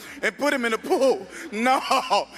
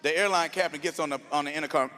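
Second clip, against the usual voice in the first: normal talking voice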